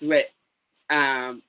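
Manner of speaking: usual speaking voice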